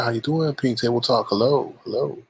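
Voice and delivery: ordinary talk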